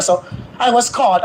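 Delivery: ordinary talk, nothing marked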